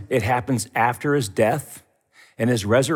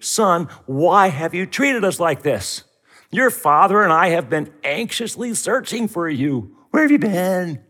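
higher, worried mother's plea; anxious mother; 6 semitones higher, 3 dB louder than usual. Retold or imitated. Preacher voicing Mary scolding her lost son, anxious. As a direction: plead like a frantic mother. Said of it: "the way that any anxious mother would respond".

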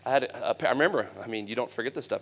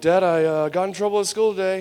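put-on high, louder hesitant kid voice; acted boyish confession, pitched up; confessing child; 7 semitones higher, 5 dB louder than usual. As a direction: own up nervously like a boy to his dad. Boy confessing to dad.

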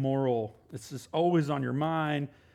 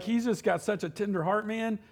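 ordinary talk, nothing marked

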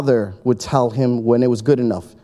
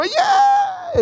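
very high whooping cheer; cheering shout. Retold or imitated. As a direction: crowd roaring its approval. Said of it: "And everybody would go".

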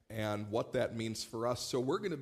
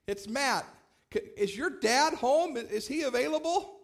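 put-on much higher, louder sing-song voice for a small child; talking to a toddler; sweet talk to a toddler; 14 semitones higher, 6 dB louder than usual. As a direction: coax a toddler on the phone. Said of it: "So I said, I said".

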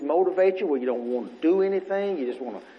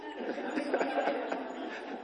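laugh; 11 dB quieter than usual. Real, not acted. Laughing at his own joke.

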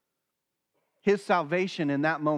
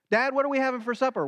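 put-on higher, very fast eager kid voice; imitating toddler; 7 semitones higher, much faster than usual. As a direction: rattle it off like an impatient toddler.

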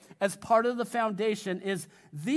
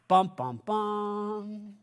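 drumroll buildup; much slower than usual. Acted. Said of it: "builds suspense before Jesus' words".

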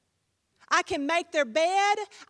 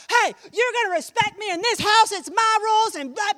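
loud, higher-pitched hammed-up scolding; mock angry parent; 7 semitones higher, 8 dB louder than usual. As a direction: lay down the law at a mock shout. Acted parent fighting with teenager.

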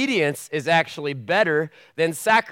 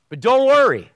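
shouted high and loud; mock-cheery high yell; comic reassurance; 10 semitones higher, 10 dB louder than usual. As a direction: blurt it out absurdly cheerful. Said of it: "Jesus looks at his disciples and says".

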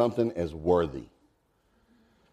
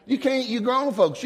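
ordinary talk, nothing marked

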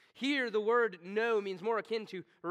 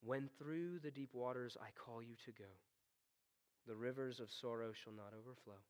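quoting a hymn; 5 semitones lower than usual. Acted. Preacher quoting a hymn to the suffering, recited. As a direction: let the old hymn speak, gently. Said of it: "the old hymn sends it well".

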